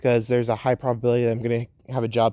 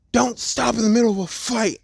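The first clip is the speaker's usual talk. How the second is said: higher, scolding fighter's line; raised-pitch heat-of-battle reprimand; scolding shout; 9 semitones higher than usual. Acted. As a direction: call it out sharply mid-fight. Acted fighter scolds her mid-fight.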